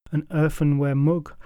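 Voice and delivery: usual speaking voice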